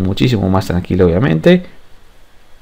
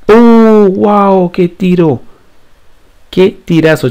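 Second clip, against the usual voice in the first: loud high burst of joy; excited goal cry; 7 semitones higher, 3 dB louder, much slower than usual. Real, not acted. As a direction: yell out at the shot. Goal celebration while playing FIFA.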